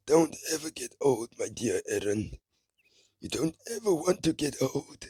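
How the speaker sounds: put-on high, creaky old man voice. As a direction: croak it like an elderly grandfather. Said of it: "I was gonna make an old man voice".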